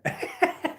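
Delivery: laugh. Real, not acted. Laughing at own receding hairline.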